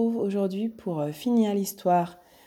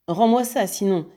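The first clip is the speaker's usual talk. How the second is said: louder, higher angry threat; threatening demand; 3 semitones higher, 4 dB louder than usual. Acted. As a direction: warn him harshly. Ganimard menace Lupin. (menace fâchée et forte)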